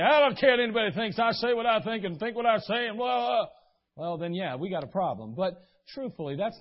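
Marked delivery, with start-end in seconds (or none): 0.0-3.5 louder, defiant mock voice; mocking a member who defies the leadership. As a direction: sneer it like a stubborn rebel. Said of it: "they ultimately say".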